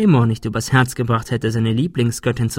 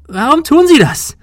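very high, loud desperate cry; voice cracking in a yell; 9 dB louder than usual. A narrator's reading. Woman demanding why from the gunman, shrieked yell. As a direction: yell until the voice tips over. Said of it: "Ihre Stimme überschlug sich".

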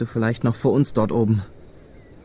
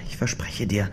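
whispering; 3 semitones lower, 6 dB quieter than usual. Acted. Leises Versprechen an den Verletzten.

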